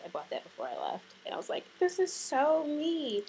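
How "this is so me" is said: high, drawn-out retold gush. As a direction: stretch it out in high-pitched delight. Retold delight at buying suitcase.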